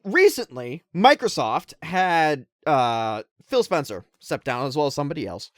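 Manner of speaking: normal talking voice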